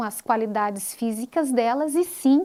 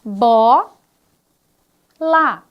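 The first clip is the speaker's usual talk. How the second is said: syllables bó and lá said apart, louder, with a pause between; syllable by syllable, as a teacher demonstrates; split into syllables; 2 semitones lower, 7 dB louder than usual. Shown as a demonstration. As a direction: break the word into its parts, loudly. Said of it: "Você fala a palavra pra ele analisando em partes".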